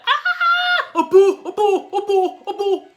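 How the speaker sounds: high, loud shrieking "A boo!" over and over; imitating sister's scream. Little sister spots the possum, imitated shrieking in alarm. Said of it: "she just screamed".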